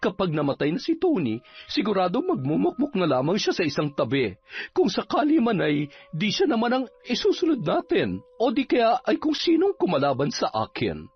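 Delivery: agitated villainess, pitch swinging upward; scheming captain. Acted. Captain plotting with Levi against Tony, scheming. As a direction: plot against them with rising menace.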